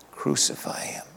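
hushed, breathless whisper of the mob's cry; whispered echo. Retold or imitated. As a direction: let it drift back like a haunting memory. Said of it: "that echo in their ears".

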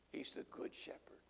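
hushed whisper for effect. Real, not acted. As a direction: lean in and whisper it. Preacher drops to a whisper.

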